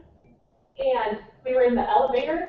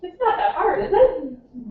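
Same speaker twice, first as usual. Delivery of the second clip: mock exasperated; 6 semitones higher, 3 dB louder, slower than usual. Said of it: "I'm like".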